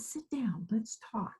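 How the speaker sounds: whispered softly, hushed; retold whisper. Professor patient whispering to nervous new therapist, hushed. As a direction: hush it like a quiet invitation. She said.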